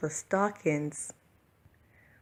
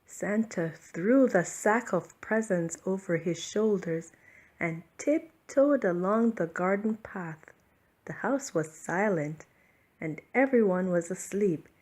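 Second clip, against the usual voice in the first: plain reading voice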